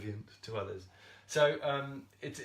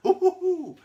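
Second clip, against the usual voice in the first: high, loud gleeful ooh ooh ooh; excited squeals; 12 dB louder than usual. Real, not acted. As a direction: squeal it out like jumping up and down with joy. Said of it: "I jump up and down".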